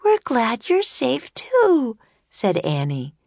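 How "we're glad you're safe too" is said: put-on high young girl, slow and relieved. A narrator's reading. Annie voiced by narrator.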